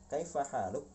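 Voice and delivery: "Kaifa haluk?" with a rising questioning tune; teacher modelling the question tone on "Kaifa haluk?". Shown as a demonstration. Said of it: "Ini nadanya nada bertanya".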